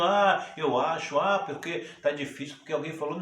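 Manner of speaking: hammed-up wavering believer; mock doubting. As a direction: act out someone making uncertain excuses. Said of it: "Eu não tenho".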